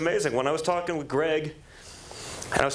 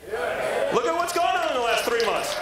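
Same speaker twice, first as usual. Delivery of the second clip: rally speech; 14 semitones higher, slower than usual. Acted.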